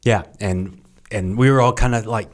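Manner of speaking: ordinary talk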